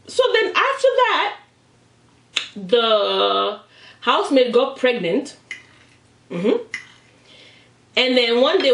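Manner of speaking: ordinary delivery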